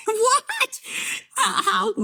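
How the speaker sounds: very high incredulous squeal; high-pitched mimicked amazement; mock astonishment. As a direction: squeak it out in disbelief, imitating amazed classmates. Imitates classmates amazed at her word.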